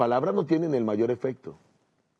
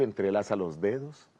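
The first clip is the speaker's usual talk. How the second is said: ordinary delivery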